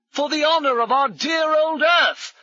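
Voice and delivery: high, loud rallying cry; shouted. A narrator's reading. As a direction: bellow a heroic battle cry. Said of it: "Brummond shouted".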